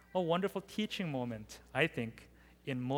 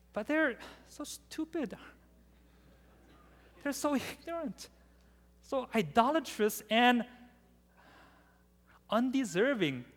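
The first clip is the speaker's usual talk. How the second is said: exasperated scorn; 6 semitones higher than usual. Pretend. Preacher mocking the people's foolishness.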